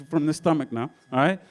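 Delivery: normal talking voice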